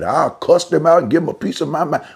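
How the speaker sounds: high and loud, acting out a hothead; mock angry outburst. Someone mad, vowing to cuss them out, loud mock anger. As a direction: blow up like someone who just got mad. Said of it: "you get mad".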